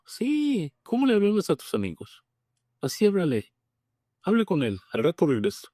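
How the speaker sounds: high, lively imitation of a priest; imitating the priest, pitched well up; priest's voice. Priest retold in testimony.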